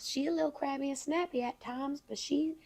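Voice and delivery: put-on Southern belle accent. Southern belle describes herself to co-host, soft accent. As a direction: modestly sum up in a Southern accent. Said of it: "She really can carry on that".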